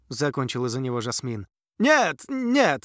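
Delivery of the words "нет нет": high, loud protesting prince. A narrator's reading. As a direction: protest in alarm. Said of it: "запротестовал Андерс".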